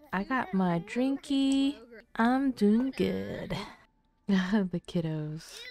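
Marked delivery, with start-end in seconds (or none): 0.1-3.5 put-on much higher, slow baby voice; voicing the toddler. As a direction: coo it like a content toddler with a bottle.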